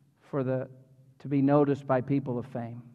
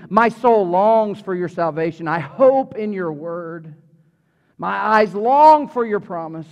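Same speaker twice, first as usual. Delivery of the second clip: slow scripture reading; 2 semitones higher, 6 dB louder, slower than usual. Acted. Reads psalm slowly.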